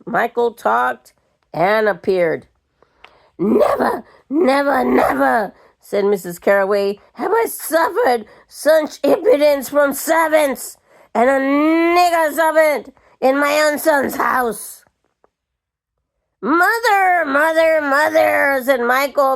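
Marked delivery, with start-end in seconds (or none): 3.4-5.5 outraged repetition. A narrator's reading. Carraway outraged at a servant. 7.1-14.8 put-on indignant old lady. A narrator's reading. Carraway outraged at a servant. 16.4-18.6 flustered plea. A narrator's reading. Michael pleads with his mother.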